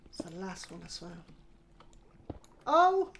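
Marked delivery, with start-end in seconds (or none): 2.7-3.1 loud high startled cry; real shock at the slip. Real, not acted. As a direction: yelp as it goes wrong. Another accidental break.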